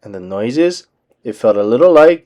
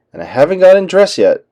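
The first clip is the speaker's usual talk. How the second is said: cried out; 6 semitones higher, 4 dB louder, faster than usual. A narrator's reading. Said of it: "he cried".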